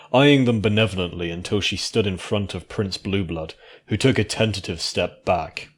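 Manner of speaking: ordinary delivery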